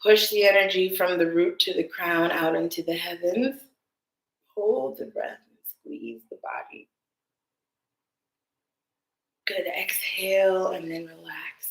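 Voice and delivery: calm, guiding meditation voice; guided meditation. Acted. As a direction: lead the breath gently. Guiding a breath practice.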